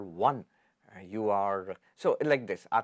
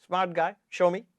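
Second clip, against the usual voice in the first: higher, teasing dare; mock challenge; 6 semitones higher than usual. Listeners daring Ashtavakra to prove it, mock challenge. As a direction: toss it out like a skeptic daring him. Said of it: "our challenge would be to Ashtavakra".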